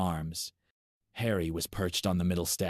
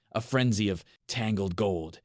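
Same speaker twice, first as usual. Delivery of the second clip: ordinary delivery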